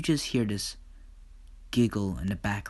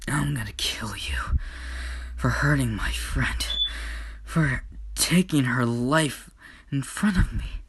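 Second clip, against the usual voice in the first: whispered death threat; 3 semitones higher than usual. Acted. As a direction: hiss the vow to kill him, barely voiced. Sister threatens brother over friend.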